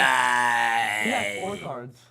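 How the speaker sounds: loud, stretched-out shout; loud correction. Real, not acted. Player correcting the game master's count, shouted and drawn out.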